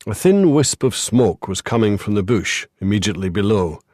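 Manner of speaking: ordinary delivery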